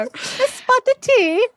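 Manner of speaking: put-on English accent. Host teasing the English guest, in a mock English accent. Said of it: "That's my English accent".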